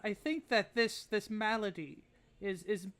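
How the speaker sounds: put-on high, grateful but grim old lady; frail old woman. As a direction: pitch up, a wounded old woman. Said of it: "but she'll go".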